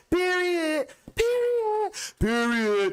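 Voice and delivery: very high, punchy repeated Period; mock girls' period. Mimicking girls co-signing with period, very high mock voice. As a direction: squeal out Period three times.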